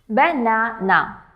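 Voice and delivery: slow "banana" as ba, na, na; broken into syllables. Shown as a demonstration. Teacher breaking words into three parts, "banana" slow and split. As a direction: sound out "banana" in three separate beats. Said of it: "three parts".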